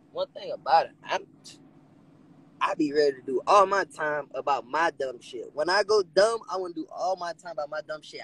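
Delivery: ordinary talk, nothing marked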